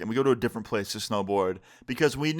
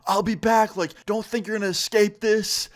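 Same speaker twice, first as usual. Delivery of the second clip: high, raised mock threat; retold yell over the shoulder, pitched up; imitated yelling; 8 semitones higher, 3 dB louder than usual. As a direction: act out her parting threat in a raised voice. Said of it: "just yelling".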